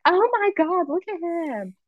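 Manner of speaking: high-pitched mock fawning; gushing admiration. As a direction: coo it in an exaggerated high voice, imitating impressed onlookers. Mimics people gushing over dads.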